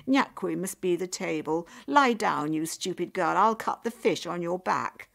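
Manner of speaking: loud, scornful orders; harsh mother. A narrator's reading. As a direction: command the despised daughter to obey. Mother harshly orders despised daughter.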